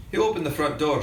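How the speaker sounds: usual speaking voice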